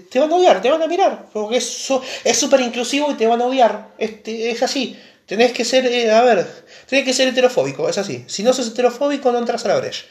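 mucho más agudo, parodiando con tono exagerado; voz aguda burlona. Acted. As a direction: imita en falsete burlón al fan de la brecha. Burla exagerada de un estereotipo. (voz aguda y burlona de parodia)

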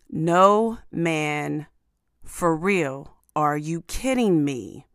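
ordinary talk

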